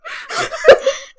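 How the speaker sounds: high giggling laugh. Real, not acted. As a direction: burst out laughing. Laugh alone.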